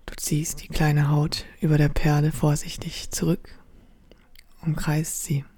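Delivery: ordinary delivery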